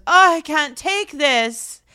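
high, loud, drawn-out complaint; mock exasperation. Mimicking Mary upset over dog hair, mock exasperated. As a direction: wail it slowly like a put-upon drama queen. Acts out Mary's dramatic complaint.

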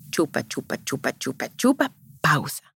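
soft rhythmic chant of chupa, chupa, then a stop on pausa; rhythmic suck-suck demo. Shown as a demonstration. Teaching mothers how a baby nurses, soft rhythmic chant. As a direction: beat out the sucking rhythm quietly. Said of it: "Cuando un bebé está en el seno".

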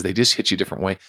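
ordinary talk

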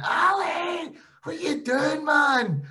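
high, far-off cry of disbelief; mock exasperated yell. Batsman yelling at his partner as the ball goes up, exasperated. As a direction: shout it up high at your partner. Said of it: "I just went".

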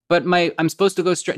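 imitated flustered objection; protesting. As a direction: argue back at the inner voice. Voicing reluctance to the inner voice.

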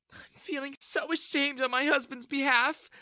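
high, tearful shame; acted sobbing. A narrator's reading. Wife ashamed of husband's behaviour, tearful. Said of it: "Jessica sobbed".

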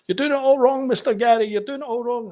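high, loud heckle from behind; imitated shout. Winston shouting at the journalist from the window, high imitated yell. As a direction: shout it out as the exasperated driver. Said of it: "starts shouting at the, the, the window".

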